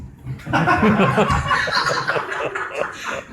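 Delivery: loud laugh with the congregation; hearty laugh. Real, not acted. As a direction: burst out laughing at the joke. Laughing at own joke.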